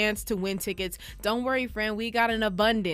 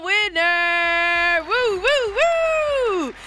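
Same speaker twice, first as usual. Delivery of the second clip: excited; 7 semitones higher, 4 dB louder, much slower than usual. Real, not acted. DJ announcing the contest winner.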